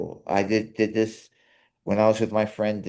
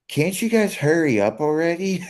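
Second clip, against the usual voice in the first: raised-pitch impatient mock complaint; higher-pitched, exasperated retold gripe; imitating impatient customer; 9 semitones higher, 3 dB louder than usual. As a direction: imitate a customer fed up with waiting. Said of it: "It sounded like, it sounded like she was like".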